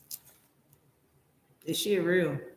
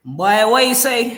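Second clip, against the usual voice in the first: put-on loud, raised Jamaican-accented challenge; 6 semitones higher, 10 dB louder than usual. Viewer confronting the video's narrator, mock Jamaican accent. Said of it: "In my Jamaican accent".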